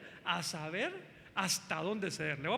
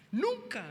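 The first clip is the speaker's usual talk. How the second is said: Nunca jumped up high and a bit louder, as a firm promise; emphatic never; 12 semitones higher, 4 dB louder than usual. Pretend. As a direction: hit Nunca hard and high. Husband urging wife, no fear. (Nunca, dicho muy agudo y enfático)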